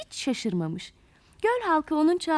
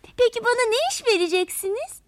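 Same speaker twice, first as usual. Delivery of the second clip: higher, eager little creature voice; eager question; 7 semitones higher than usual. A narrator's reading. Little creature asking the water plant for a job, eager. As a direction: ask keenly, lifting the pitch.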